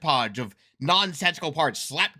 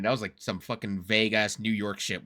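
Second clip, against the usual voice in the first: ordinary delivery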